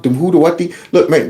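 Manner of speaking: usual speaking voice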